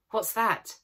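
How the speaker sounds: startled high question; sudden fright at a noise; alarmed. A narrator's reading.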